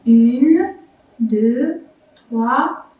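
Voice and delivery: compte lentement les syllabes; counting syllables. Shown as a demonstration. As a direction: compte doucement en détachant. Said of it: "on compte". (comptage lent des syllabes)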